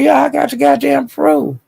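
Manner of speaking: high, confident Kendra impression. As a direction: pitch up to play Kendra sure of herself. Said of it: "little Kendra said".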